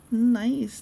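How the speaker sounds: loud, bright burst of praise; real enthusiastic exclamation; excited nice. Real, not acted. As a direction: cheer it out. Reacting to chat.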